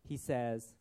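ordinary delivery

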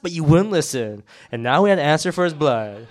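loud, exasperated blame; loud rebuke. Acted. Acted rebuke by Reuben continues.

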